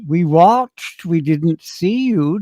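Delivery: high, slow, drawn-out parent voice; puzzled parents. Acted. Parents phoning their son after missing him on TV, puzzled. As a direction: draw it out high like baffled parents on the phone. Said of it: "getting the phone calls, you know, from my parents".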